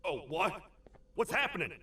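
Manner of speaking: sudden high alarmed cry; startled. Acted. Toad startled when the door slams shut. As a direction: jump at the sudden noise.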